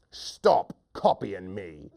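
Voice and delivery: slow, low, deliberate order; stern warning. Acted. Hunter fed up with his echo, slow low warning. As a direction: space out each word as a warning.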